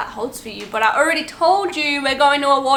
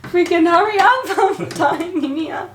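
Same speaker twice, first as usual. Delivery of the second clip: laughing complaint; 6 semitones higher than usual. Retold or imitated. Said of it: "I'm just like".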